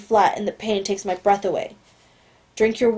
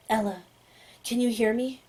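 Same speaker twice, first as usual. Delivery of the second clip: hushed call; 6 dB quieter than usual. Acted. Calling Ella silently, telepathically.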